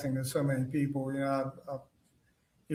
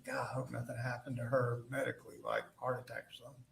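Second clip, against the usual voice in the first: quick, much softer, like thinking to himself; inner thought; 2 semitones lower, 9 dB quieter, faster than usual. Retold or imitated. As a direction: rush it under your breath as a private worry. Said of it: "I'm thinking".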